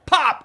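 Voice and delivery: high yelped pop of a rib; pop sound. Retold or imitated. Telling how his rib broke, a high yelped pop. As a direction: cry out the crack of a rib. Ribs cracking in the hug.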